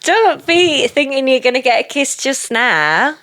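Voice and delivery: high, slow, hammed-up teasing voice. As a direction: tease him in a big put-on girly voice. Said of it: "And then she's like".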